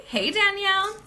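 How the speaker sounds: usual speaking voice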